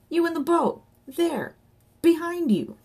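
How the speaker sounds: high, urgent calls; short bursts called across the water; shouting to boaters. A narrator's reading. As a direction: hail someone far away in alarm. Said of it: "shouted Johnny".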